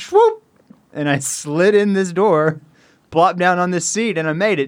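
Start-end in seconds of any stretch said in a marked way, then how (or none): 0.0-0.4 high sliding swoop noise; swoop sound effect. Retold or imitated. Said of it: "and then I went".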